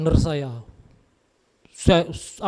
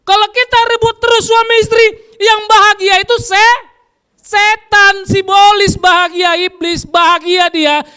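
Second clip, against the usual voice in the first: much higher, loud preaching cry; preaching shout; 12 semitones higher, 9 dB louder than usual. Real, not acted. Preacher crying out to the congregation, high and loud. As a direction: shout it out to the whole church.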